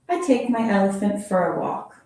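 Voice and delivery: ordinary delivery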